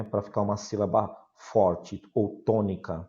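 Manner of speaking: usual speaking voice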